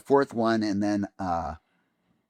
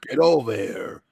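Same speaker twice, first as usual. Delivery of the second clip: slow, louder gruff growl; gruff Kano voice; 5 dB louder than usual. Shown as a demonstration. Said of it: "in the gruff Kano voice, like that".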